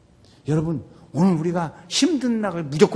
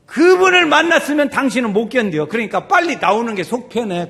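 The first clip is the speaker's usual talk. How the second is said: loud, high-pitched retold advice; retold warning; 6 semitones higher, 10 dB louder than usual. Church member warns a newcomer to come quickly, loud retold warning. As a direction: push the quoted warning out loud.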